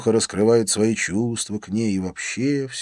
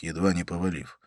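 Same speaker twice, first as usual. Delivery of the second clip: ordinary delivery, nothing marked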